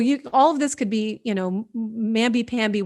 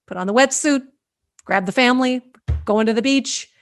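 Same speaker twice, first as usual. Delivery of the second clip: normal talking voice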